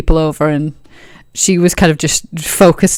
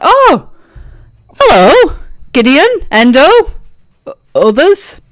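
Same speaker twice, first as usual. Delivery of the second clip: higher, louder, hearty hello; Ro's greeting; 9 semitones higher, 3 dB louder than usual. Acted. Ro finally notices the others and greets them. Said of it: "she's like".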